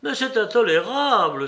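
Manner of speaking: put-on young magistrate protests in indignation; indignant cry. A narrator's reading. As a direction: cry out that this cannot be allowed. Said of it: "s'écria le petit jeune homme".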